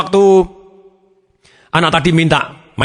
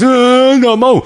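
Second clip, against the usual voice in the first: high, louder mock wail; crying child; 10 semitones higher, 4 dB louder, much slower than usual. Child crying when refused, mock wail. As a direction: imitate a kid bawling in refusal. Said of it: "ketika dia nangis".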